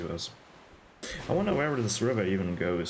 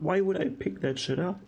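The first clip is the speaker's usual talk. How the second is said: high, incredulous outburst; exasperated disbelief; 10 semitones higher than usual. Real, not acted. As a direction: pitch up in disgusted disbelief. Disgusted disbelief at loot.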